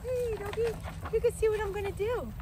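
high, fast sing-song to a dog; pet talk. Real, not acted. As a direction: coo at the dog. Talking to her dog.

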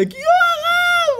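high, loud, slow squealed call; excited woman calling. Retold or imitated. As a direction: call out across the street to the rabbi, thrilled. Woman calls out to rabbi.

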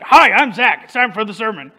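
lightly hammed-up imitation of a preacher; loud, punchy greeting, then settle back; mock self-introduction. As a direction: play at being the preacher opening up. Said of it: "somebody doing an imitation of me".

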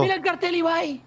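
high, eager call to a friend; real excited gamer call. Real, not acted. Calling Ibai to see sign.

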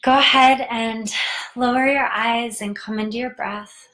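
measured meditation voice with long pauses; guided meditation. Real, not acted. Host guides listeners into tarantula meditation, unhurried guided-meditation voice. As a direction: guide them inward, leaving room to breathe.

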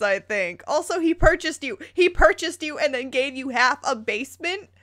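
sassy lecture to the character; incredulous scolding. Real, not acted.

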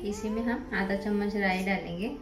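usual speaking voice

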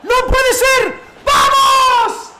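high, loud victory scream; real gamer celebration yell; ecstatic shout. Real, not acted. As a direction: roar it like a last-minute winner. Goal celebration while playing.

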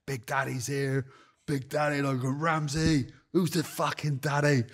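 lightly hammed drunken swagger; drunk bravado. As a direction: drunk at the VIP bar, taunting Ramsay with slow swagger. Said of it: "I sound like".

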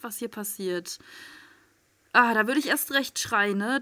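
usual speaking voice

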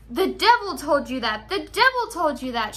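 loud enraged yell; furious shriek. Acted. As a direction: scream it in a fury. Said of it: "shrieked Rumpelstiltskin".

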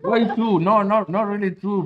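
high, loud disbelieving objection; loud protest. Real, not acted. Streamer disputing co-host's score of two, loud protest. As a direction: push back loudly, pitched right up.

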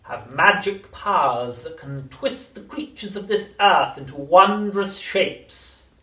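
very slow, throaty, menacing crone; throaty witch whisper. A narrator's reading. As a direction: lean in and menace. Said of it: "whispering in a throaty sort of voice".

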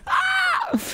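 sudden high-pitched scream. Real, not acted. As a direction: let out a short, very high shriek. Screams during play.

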